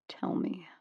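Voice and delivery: god softly coaxing a frightened woman. A narrator's reading. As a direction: lure the answer out gently. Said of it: "he coaxed".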